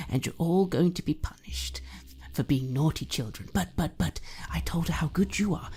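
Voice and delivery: put-on high, wheedling drunk mother with sudden whispers; creepy doting mother. Mother wheedling her grown son Joshy, creepy doting voice. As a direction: coax your son sweetly, menace underneath.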